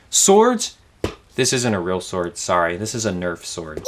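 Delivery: normal talking voice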